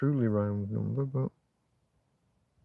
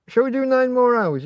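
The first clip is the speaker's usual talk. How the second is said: higher, louder mock-eager offer; playful tease; 9 semitones higher, 7 dB louder than usual. A streamer teasing his viewers about staying on, playful and mock-eager. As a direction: dangle a ridiculous idea at the chat.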